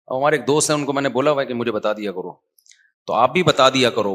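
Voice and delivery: ordinary delivery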